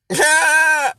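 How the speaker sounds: loud, high short cry; sudden high outburst; high yelp. Real, not acted. Caps the rhetorical question.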